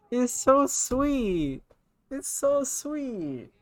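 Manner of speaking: very high, drawn-out wail; tearful, touched. Real, not acted. Reader moved by a friend's tribute, high slow tearful wail. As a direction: whimper it slowly, overcome by kindness. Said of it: "I was just crying and trying to read".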